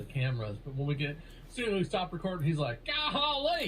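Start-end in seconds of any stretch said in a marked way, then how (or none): 2.9-3.7 high hammed-up country yokel; mock country voice. Mocking Mark's off-camera voice, high hammed-up yokel. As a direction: play up a rural twang. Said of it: "Mark's actual voice slipping out".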